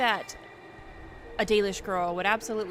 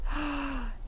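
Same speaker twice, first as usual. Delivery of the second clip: sharp little gasp of delight, pitched up; 6 semitones higher than usual. Real, not acted. Gamer spotting freckles on her character, delighted gasp. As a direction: gasp as if spotting something lovely.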